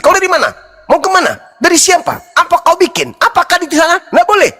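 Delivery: high, rapid-fire nagging questions; nagging wife. Retold or imitated. Husband mimicking his nagging wife's interrogation. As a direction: pepper him like a suspicious wife. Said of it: "bini yang sudah cerewet sekali".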